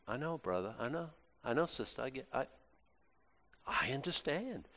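very soft, gentle comfort; tender reassurance. Retold or imitated. Priest comforts a crying penitent, very softly and tenderly. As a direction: soothe the crying sinner.

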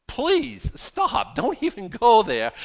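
high, loud, exasperated plea; exasperated interruption. Real, not acted. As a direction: cut their excuses off forcefully. Cutting off listeners' excuses.

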